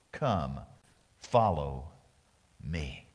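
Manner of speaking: low, slow, gentle call of Jesus; deep and unhurried, like the Lord inviting; a quiet, low-voiced Jesus beckoning; Jesus's call. Jesus's words quoted, deep and slow.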